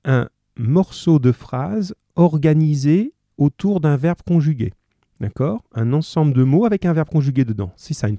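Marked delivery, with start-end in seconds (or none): 0.0-4.7 slow, deliberate explaining; slow definition. Shown as a demonstration. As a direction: spell out the definition slowly. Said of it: "Proposition, ça veut dire".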